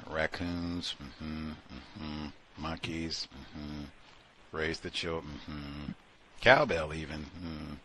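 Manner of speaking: soft murmured mm-hmms; quiet noting. Shown as a demonstration. Listing the caller's topics, soft murmured mm-hmm. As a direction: quietly tick off each item. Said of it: "observe, don't need to say anything".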